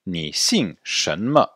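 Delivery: Ni xing Shenme at under half speed; slow model question. Shown as a demonstration. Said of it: "Répétez". (Ni xing Shenme, très lentement)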